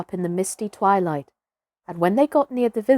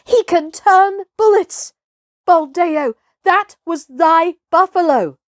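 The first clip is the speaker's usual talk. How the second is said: crowd yelling; 9 semitones higher, 6 dB louder than usual. Acted.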